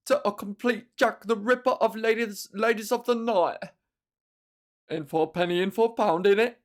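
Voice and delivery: a hint of London geezer; mock British self-pity; cockney musing. Continuing cockney character.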